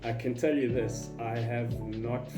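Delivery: ordinary talk, nothing marked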